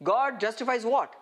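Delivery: ordinary talk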